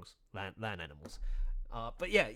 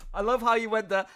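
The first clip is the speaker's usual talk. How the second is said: ordinary talk, nothing marked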